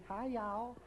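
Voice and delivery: lightly mocking Texan accent. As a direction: playfully imitate a Texan hello. Host echoes her Texan greeting.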